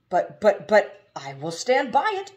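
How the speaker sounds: loud, high, stammering jolly giant; stammer, then stand firm and loud; booming driver. A narrator's reading. Mister Butt promises Haroun he means it.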